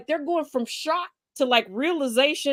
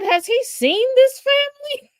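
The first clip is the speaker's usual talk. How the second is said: put-on high, louder, baffled old-woman voice; innocent old lady; 12 semitones higher, 5 dB louder than usual. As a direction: ask it like a puzzled grandma in hospice. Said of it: "she said".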